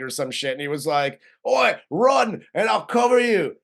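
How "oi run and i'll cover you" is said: mock British soldier, higher and louder; wounded British henchman playing the hero, British accent. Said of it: "I'm pretty sure that guy's British".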